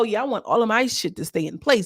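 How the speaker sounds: normal talking voice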